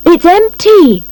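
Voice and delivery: high, loud, drawn-out shout; loud call, pitched well up. A narrator's reading. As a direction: shout it slowly to a crowd. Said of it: "he shouts".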